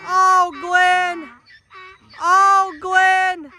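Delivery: high, loud horse voice calling out; voicing the horse. Voiced horse calls Glenn.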